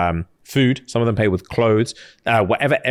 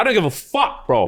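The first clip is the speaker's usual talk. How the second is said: much higher-pitched, offhand brush-off; dismissive High Lord; 7 semitones higher than usual. Retold or imitated. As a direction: toss it away like you couldn't care less. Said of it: "he's like".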